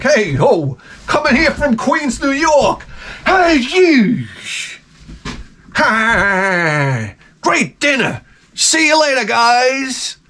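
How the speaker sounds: high, hammed-up Queens tourist shark; a cheery shark on vacation from Queens, saying goodbye after dinner; Jaws as a New York tourist.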